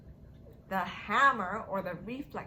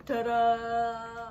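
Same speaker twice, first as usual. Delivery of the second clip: reveal; 6 dB louder than usual. Acted. Reveal in memory game.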